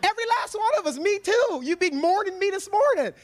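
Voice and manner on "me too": ordinary talk, nothing marked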